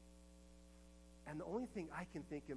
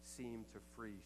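higher and louder, impassioned sermon voice; preaching at full force; 5 semitones higher, 8 dB louder than usual. Acted. Preacher retelling a survivor's memory to the congregation, impassioned and loud. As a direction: preach her words out to the whole room.

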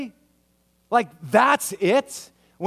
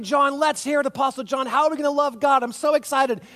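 higher, bright mock excitement; mock excited; 6 semitones higher than usual. Crowd urging John to reveal how to love God, mock excited. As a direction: play the eager fan egging John on. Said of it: "everyone's getting ready".

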